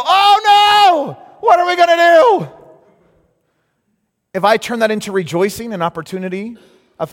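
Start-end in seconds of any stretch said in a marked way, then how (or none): 0.0-2.5 high, loud panicked cry; hammed-up alarm over empty funds; loud, high, panicky wail; mock panic. Panicking over the bank account.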